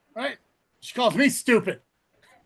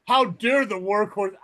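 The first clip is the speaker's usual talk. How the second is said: mock indignation; slower than usual. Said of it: "You don't see me sitting there like".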